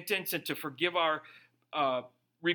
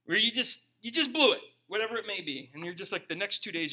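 usual speaking voice

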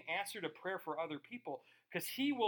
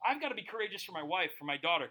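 ordinary talk

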